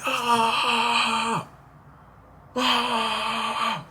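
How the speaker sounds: long high-pitched scream, held back in volume; imitated scream. Telling how he let rage out, imitated scream. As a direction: draw out a high, restrained "aah". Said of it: "I would just scream".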